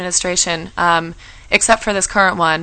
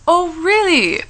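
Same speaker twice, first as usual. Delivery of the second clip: high, drawn-out intrigued reaction; acted wide-eyed "oh, really"; intrigued surprise; 10 semitones higher, much slower than usual. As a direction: react with sudden high-pitched interest. Acts out her interested reaction.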